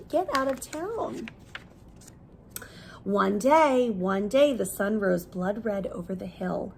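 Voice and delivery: usual speaking voice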